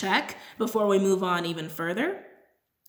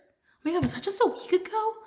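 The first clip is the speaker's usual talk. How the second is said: high, amazed disbelief; real surprise at how fast time went; surprised; 11 semitones higher than usual. Real, not acted. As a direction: caught off guard by the date. Realizing how fast time passed.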